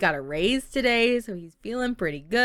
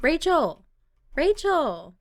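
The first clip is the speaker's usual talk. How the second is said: calling out; 9 semitones higher, 4 dB louder than usual. Acted. Diana calling out to Rachel.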